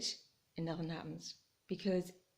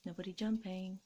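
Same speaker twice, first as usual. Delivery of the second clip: normal talking voice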